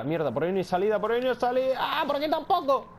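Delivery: high, frantic panicked cry; cornered and scrambling for a way out; panicked yelling. Real, not acted. Trapped while fleeing an enemy.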